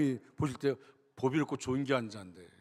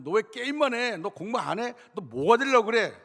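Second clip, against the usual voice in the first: put-on loud, higher scolding of the kids; nagging parent; 6 semitones higher, 6 dB louder than usual. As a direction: imitate a parent telling off the children, voice climbing. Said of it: "애들에게 막 잔소리하고".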